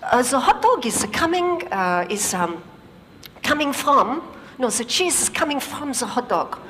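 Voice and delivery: put-on light French accent, groping for words; a hint of a French engineer struggling. French engineer's third attempt at explaining, light French accent. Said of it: "He says".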